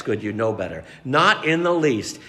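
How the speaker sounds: ordinary talk, nothing marked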